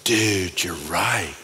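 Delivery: low, soft sheepish concession; sheepish admission. Retold or imitated. Neighbor admitting the pastor is right, low soft mumble. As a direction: let it drop low and quiet.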